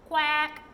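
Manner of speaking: a single loud creature quack. A narrator's reading. Snoogle passing the hidden children, loud quack. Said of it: "he said loudly".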